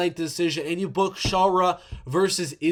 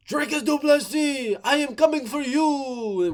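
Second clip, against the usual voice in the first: mock French-Dagestani accent, much higher and slow; higher, slow French-accented callout; Imavov's French accent; 9 semitones higher than usual. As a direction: call out the champ in a hammed-up French accent. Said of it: "with his French accent and Dagestani mix there".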